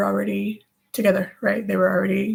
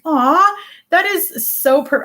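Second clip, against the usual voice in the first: high, loud drawn-out "aw"; touched, voice lifting high; touched gushing; 10 semitones higher, 6 dB louder, slower than usual. Real, not acted. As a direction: gush at a sweet compliment. Gushes at a viewer's comment.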